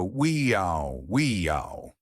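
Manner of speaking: slow glide from high wee to low yow; siren sweep. Shown as a demonstration. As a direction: slide the voice down like a siren. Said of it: "The we is high, the A W is low".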